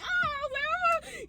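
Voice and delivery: high, soft mock "ah"; tiny hammed-up wail held back; mock scream. Answering the screaming friend, mock scream.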